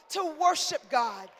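loud, high shouted praise, words stretched out; full-force preaching. Real, not acted. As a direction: cry out thanks to God before the congregation.

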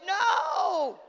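long, loud, high wailed no; hammed-up tearful protest; mock crying wail. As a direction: wail it out like a comic sob. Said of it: "I'm crying like".

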